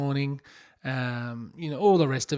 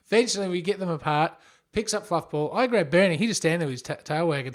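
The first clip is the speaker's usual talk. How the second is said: ordinary talk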